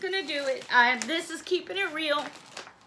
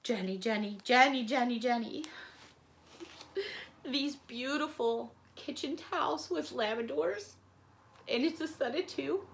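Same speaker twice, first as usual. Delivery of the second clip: choked up; 2 semitones lower, 7 dB quieter than usual. Real, not acted. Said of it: "I know I'm gonna start crying more".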